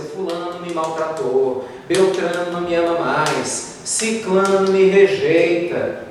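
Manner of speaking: slow, drawn-out list of grievances; acted self-pitying lament; mock complaining. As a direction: drag out each complaint, building slightly. Voicing someone dwelling on grievances.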